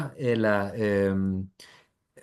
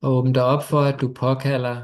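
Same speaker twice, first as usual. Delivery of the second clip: guided meditation. Acted. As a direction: ease them gently into stillness.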